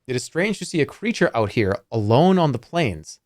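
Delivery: ordinary delivery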